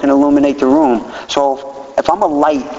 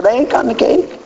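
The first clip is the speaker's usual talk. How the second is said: put-on high, insistent little girl voice, much higher; imitating daughter; 12 semitones higher than usual. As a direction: pipe up like an impatient daughter.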